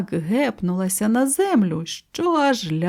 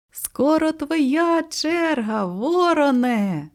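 high, drawn-out call, no real shout; 8 semitones higher, slower than usual. A narrator's reading. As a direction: sing it out slowly from the swing. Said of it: "закричала мама Му".

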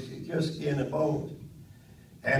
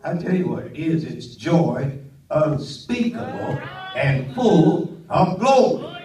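higher, louder revival preaching; full-force preaching; 6 semitones higher, 8 dB louder than usual. Acted. Preacher rousing his congregation at full force. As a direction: lift the congregation, preach it hard.

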